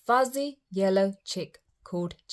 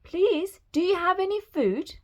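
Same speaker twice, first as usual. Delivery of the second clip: high, pleading request; calling up; 10 semitones higher than usual. A narrator's reading. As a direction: plead up to someone huge. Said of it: "Jack shouted up".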